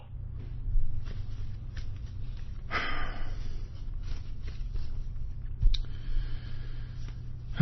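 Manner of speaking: very long, soft exhale; frustrated sigh. Real, not acted. Broadcaster fed up with problems, long frustrated sigh. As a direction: let out a drawn-out tired breath.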